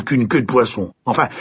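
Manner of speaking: ordinary delivery, nothing marked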